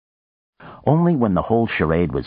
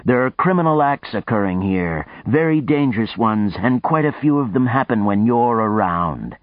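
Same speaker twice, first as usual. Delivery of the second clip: angry warning; 7 semitones higher, 3 dB louder than usual. Acted. Houston losing his temper.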